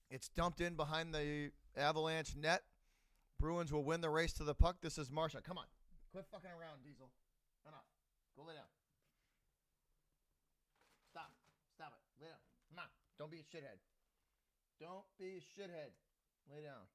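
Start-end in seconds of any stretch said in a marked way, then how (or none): 5.4-16.9 hushed scolding. Real, not acted. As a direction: scold the dog under your breath. Scolding the dog mid-stream.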